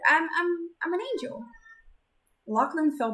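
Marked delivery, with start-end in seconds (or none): 0.1-1.4 higher, hesitant confession; timid reveal; shy girl voice. Acted. Zenith confesses.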